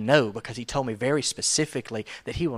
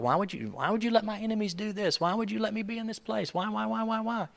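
crying out to God; 10 semitones higher than usual. Retold or imitated. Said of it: "he cries out to God".

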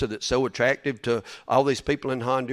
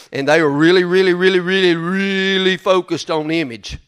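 mocking emphasis on really; stress on really; 5 semitones higher, 7 dB louder than usual. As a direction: pile up each really harder. Mocking image-focused speakers.

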